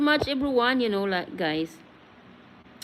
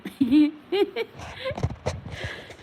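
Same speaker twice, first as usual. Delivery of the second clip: drawn-out giggle, pitched up; real amused laughter; long high laugh; 9 semitones higher than usual. Real, not acted. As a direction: keep laughing at the joke. Laughing after joke.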